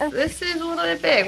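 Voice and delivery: ordinary talk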